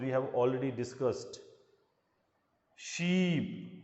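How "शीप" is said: शीप with the ee held long and slow; long ee sound. Shown as a demonstration. Teacher showing exam students the long ee, slowly stretched. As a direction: draw out the ee in शीप. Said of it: "ये बड़ी ई की ध्वनि आ रही है".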